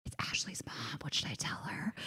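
hushed, conspiratorial aside; stage whisper. Retold or imitated. Friend on the phone to roommate, secretive whisper. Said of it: "whisper to Bridget".

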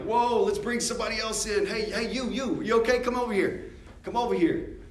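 calling out. Retold or imitated. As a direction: wave someone over with your voice. Calling someone over warmly.

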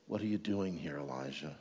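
a soft murmured voice of God; murmuring voice of God. Acted. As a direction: ask it gently, almost a murmur. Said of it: "a whispering voice".

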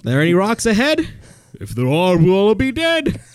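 high put-on characters; Princess Bride voices. Reciting the movie, high comic voices. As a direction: jump between movie characters.

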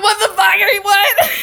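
high, loud panicked cry half-laughing; panicked shout. Retold or imitated. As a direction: yelp it out in shocked fright.

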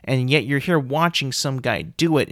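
usual speaking voice

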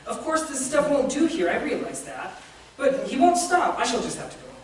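a hint of a petulant ghost complaining; fussy ghost. A narrator's reading. A mildly fretful ghost whining about his lizard. Ghost character voiced in reading.

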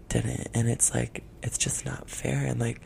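conspiratorial whisper; hushed, secretive complaint. Real, not acted. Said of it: "we're gonna whisper this whole time".

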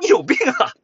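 mock exasperated tester; annoyed retort. Tester snapping back. (高声而恼火地回嘴)

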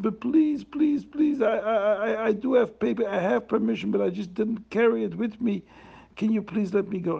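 high, pleading voice of the poor traveler; a frightened poor man pleading with the officers; desperate begging. Acted. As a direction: beg them to let you go. Poor man begs to be released.

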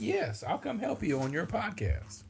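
high, softly squeaked agreement; eager mock reply. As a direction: act out an innocent, high-pitched yes. Acted earnest reply in mock quarrel.

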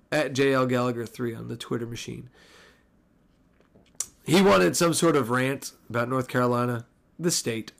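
ordinary talk, nothing marked

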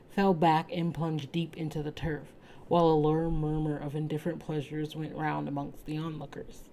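usual speaking voice

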